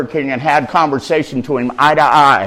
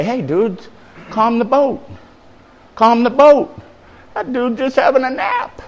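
casual soothing surfer-dude paraphrase; higher, easy voice telling them to settle; Jesus; 8 semitones higher than usual. Acted. Jesus calming the mourners, casually higher. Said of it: "Jesus has the power to say".

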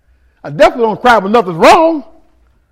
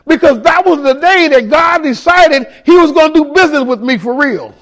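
preaching shout. Acted. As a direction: proclaim it to the back row. Preaching climax.

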